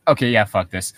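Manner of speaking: ordinary delivery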